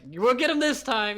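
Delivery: high, drawn-out fake optimism; mock excited. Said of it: "how are you gonna be excited".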